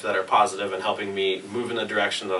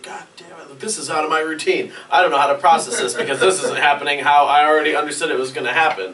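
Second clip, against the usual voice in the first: mock freak out; 4 semitones higher, 6 dB louder than usual. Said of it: "is freak out".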